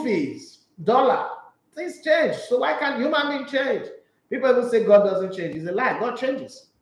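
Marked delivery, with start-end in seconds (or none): none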